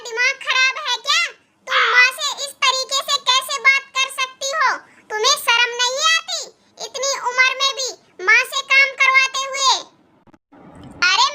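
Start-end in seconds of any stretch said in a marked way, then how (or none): none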